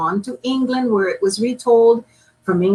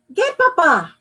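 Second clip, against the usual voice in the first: urgent order; 9 semitones higher, 3 dB louder than usual. Acted. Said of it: "Mama cried".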